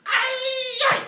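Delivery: very high, loud barked cry; battle cry. A narrator's reading. Shell-shocked vet waking with a battle cry. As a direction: bark it like a soldier. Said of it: "Mister San Anselmo barked".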